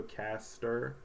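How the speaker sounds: "caster" slowly as "cast... er"; sounding out. Shown as a demonstration. Teacher showing E R in a word, slow syllables. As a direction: draw out "caster" in two soft parts. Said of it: "E R".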